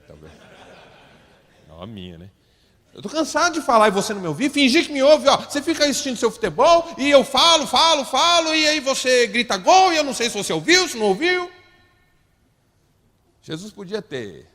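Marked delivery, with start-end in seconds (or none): 2.9-11.5 loud, high mock rant of a fed-up wife; hammed-up complaint, fast at first; nagging wife. Wife scolding football-watching husband.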